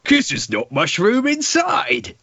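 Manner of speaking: very high, slow, hammed-up delivery; milk the pun like a vaudeville comic; stage-comic punchline. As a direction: squeal out the groaner punchline slowly. Cockney gag punchline.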